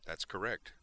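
straight, composed confirmation; understated seriousness; as serious as possible. A narrator's reading. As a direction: confirm it gravely, keeping amusement out. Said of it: "in as serious a voice as she could manage".

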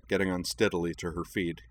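usual speaking voice

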